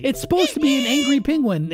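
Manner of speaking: ordinary talk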